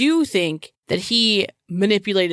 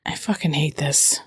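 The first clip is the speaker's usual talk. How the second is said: hushed disgusted whisper; 3 semitones lower than usual. Real, not acted. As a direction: spit it out under breath.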